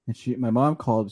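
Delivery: ordinary talk, nothing marked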